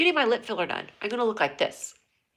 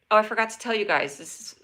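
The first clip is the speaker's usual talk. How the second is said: ordinary talk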